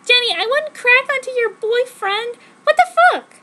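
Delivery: high, loud hammed-up tearful girl voice; mock sob through the protest; overacted teary outrage; sadly. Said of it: "I said sadly".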